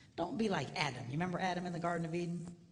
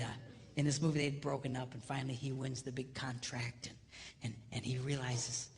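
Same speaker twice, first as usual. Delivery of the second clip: normal talking voice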